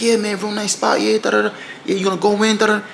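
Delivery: high, eager hustler voice; mock friendly attendant voice; parking attendant.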